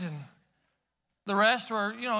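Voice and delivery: ordinary talk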